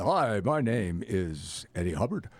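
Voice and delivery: slow, drawn-out disc jockey introduction; Eddie Hubbard. Retold or imitated. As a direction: imitate a radio host introducing himself. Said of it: "And all of a sudden".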